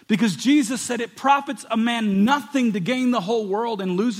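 loud, high pulpit preaching; fervent preaching. Acted. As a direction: preach it hard, pushing each line. Preaching at full force.

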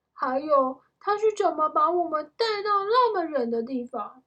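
high, loud wondering question; amazed questioning. A narrator's reading. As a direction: press for an explanation in amazement. Jack presses Annie with questions.

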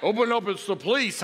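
mock gruff policeman, loud; deep man voice. Wife playing cop at the door, mock man voice. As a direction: imitate a woman putting on a man's voice. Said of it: "goes in this real deep man voice".

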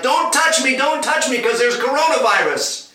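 high, loud panicky warning; hammed-up urgent yelp to keep off; mock alarm. Jesus to clinging Mary as a joke, mock alarm.

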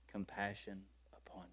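whispered softly with tenderness. Retold or imitated. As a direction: breathe the words like a tender secret. Quoting Jesus tenderly.

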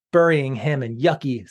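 ordinary delivery

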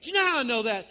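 normal talking voice

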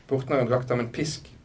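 plain reading voice

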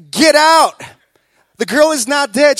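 loud and high, a firm command to clear the room; commanding shout. Acted. Jesus ordering the mourners out, commanding shout. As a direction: order them out of the house, full voice.

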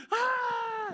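high, sharp cry of release; relieved yell. Retold or imitated. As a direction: burst out with a yelp. Jumping out of the hatch.

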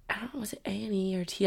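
whispered wondering aside. Real, not acted.

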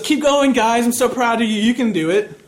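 high, loud imitation of a cheering friend; yelled encouragement. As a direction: cheer them on as if calling up, pitched high. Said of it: "Kathleen yells up to us".

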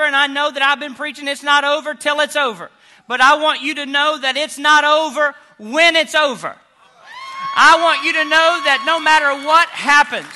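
loud, ringing sermon voice, voicing Jesus; preaching at full force. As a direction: preach it with rising fire.

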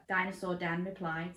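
ordinary delivery, nothing marked

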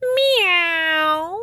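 high, stretched cat meow; just a plain cat meow. Acted. What the farmer hears from the cat, a high meow. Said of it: "all Maggie heard was".

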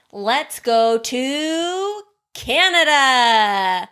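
stretched out, slow, dreamy; slow dreamy invitation. Shown as a demonstration. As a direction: draw it out like a tour guide. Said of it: "In your mind's eye".

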